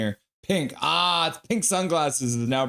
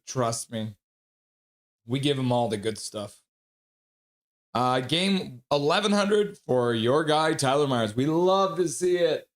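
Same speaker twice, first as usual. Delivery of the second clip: usual speaking voice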